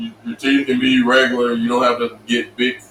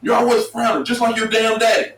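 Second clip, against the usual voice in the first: higher, put-on coach voice; coach teasing; 8 semitones higher than usual. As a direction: rib the kid about his dad in a mocking coach voice. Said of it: "He's like".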